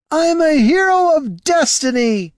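loud, slow, very high holler; whooping high-pitched proclamation. A narrator's reading. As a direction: shout it proudly, stretching the words. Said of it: "hollered the elf".

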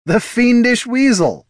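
high, loud, drawn-out cry of amusement; amused high-pitched cry; laughed exclamation. Acted. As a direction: crow it high and slow, as if laughing. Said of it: "laughed Heraldon".

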